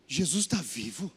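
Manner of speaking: low and slow, as if it just dawned; awestruck. Retold or imitated. Young man realizing Jesus lives, low awestruck. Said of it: "e ele".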